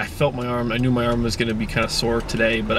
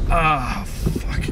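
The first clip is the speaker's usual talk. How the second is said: whispered pained curse; biting down on pain. Real, not acted. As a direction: breathe the curse out through pain. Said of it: "I'm in a lot of pain right now".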